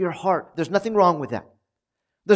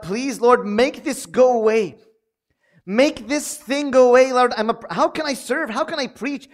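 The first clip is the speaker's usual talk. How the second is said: anxious plea to God; 7 semitones higher, 3 dB louder than usual. Retold or imitated. Anxious preacher begging God.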